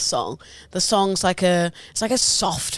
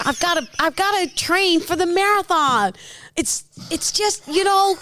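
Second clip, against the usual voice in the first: unfit New Yorker; 6 semitones higher than usual. Acted. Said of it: "unfit, sort of overweight New Yorker".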